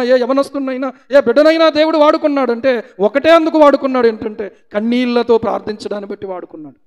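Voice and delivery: rising preacher's fervour; fiery preaching. Acted. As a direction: preach it out to the congregation. Preaching rises to full force.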